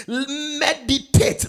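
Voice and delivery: meditate, loud and high; punched word. Real, not acted. Preacher punching key word.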